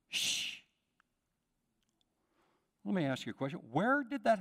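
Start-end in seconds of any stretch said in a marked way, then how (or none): 0.1-0.6 hushing. Acted. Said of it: "those that told him".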